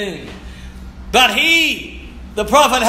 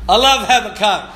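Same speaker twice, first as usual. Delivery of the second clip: normal talking voice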